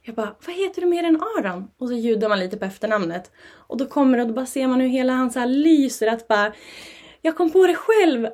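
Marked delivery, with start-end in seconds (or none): 0.5-1.7 coaxing question. Retold or imitated. Mother prompting child's name. 7.2-8.3 put-on proud child. Child's proud reply voiced.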